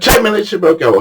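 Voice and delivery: high-pitched promise to leave; mock bargaining. Victor to his cold fishing mate, insistent bargaining.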